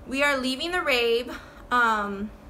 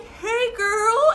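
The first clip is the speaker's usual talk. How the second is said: put-on high, sing-song drunk hey girl; drunk sister greeting; 11 semitones higher, 6 dB louder than usual. Drunk sister greeting her sibling, high sing-song. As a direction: mimic a wasted sister cooing. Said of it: "she's like".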